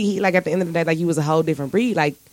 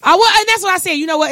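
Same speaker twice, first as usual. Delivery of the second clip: high, louder burst of agreement; excited agreement; 11 semitones higher, 5 dB louder, faster than usual. Real, not acted. Podcaster eagerly agreeing with cohost, high loud burst. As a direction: jump in eagerly, pitched up.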